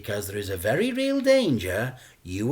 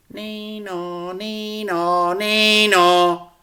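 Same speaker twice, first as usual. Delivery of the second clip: high nee-naw siren, getting louder; police siren; 9 semitones higher, 6 dB louder, much slower than usual. Acted. The narrator imitates the approaching police car, a high siren call. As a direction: voice a wailing siren.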